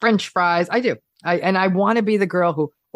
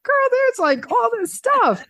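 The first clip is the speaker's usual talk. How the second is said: much higher, slow, delighted gushing; excited at the buffet; slower than usual. Pretend. At a buffet of croissants, gushing with delight. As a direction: gush over the desserts like a kid in a candy store. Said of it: "I'm like".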